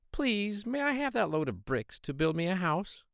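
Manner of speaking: high, polite little pig voice. Acted. Third pig asking the man for bricks, high polite piggy voice. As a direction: ask sweetly like a small pig.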